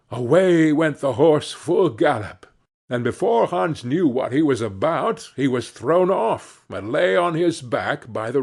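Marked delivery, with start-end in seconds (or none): none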